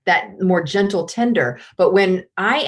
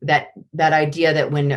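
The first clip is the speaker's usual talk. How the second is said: ordinary delivery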